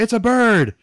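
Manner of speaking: put-on high, loud little-girl cry; excited kid calling out a sighting, hammed up. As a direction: play a little girl shouting up at the sky. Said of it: "it's, like, a little girl".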